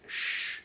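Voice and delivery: SH as air only between the teeth; voiceless sh sound. Shown as a demonstration. Teacher demonstrating French ch, voiceless hiss. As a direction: push air through for SH, no voice. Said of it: "la dificultad con la C y la H".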